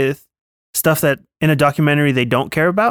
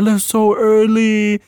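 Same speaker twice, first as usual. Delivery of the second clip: high, drawn-out near-crying whine; slow hammed-up lament; mock upset; 6 semitones higher, slower than usual. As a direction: imitate someone almost in tears. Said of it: "he's so upset".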